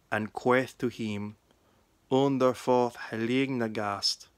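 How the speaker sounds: put-on West Saxon Old English reading, steady. Shown as a demonstration. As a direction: read the Gospel in Old English, 'queth' said as kweth. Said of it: "The reading is from the Gospel of John in West Saxon".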